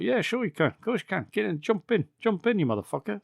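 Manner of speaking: squeaky high, friendly lady offering a ride; high mock woman voice. Retelling the film, driver invites them in high-pitched. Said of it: "The woman's like".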